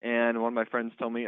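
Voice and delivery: ordinary talk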